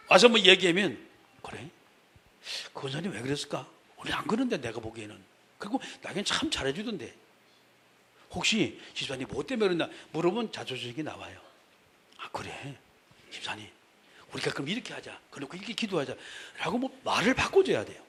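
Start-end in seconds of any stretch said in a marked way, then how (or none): 1.4-9.9 soft, quiet questioning; calm neutral listener. Retold or imitated. Deaconess gently questioning a complaining member, quiet. 12.2-16.8 very soft, lower; calm them down quietly; soft counsel. Retold or imitated. Deaconess softly advising the deacon to pray.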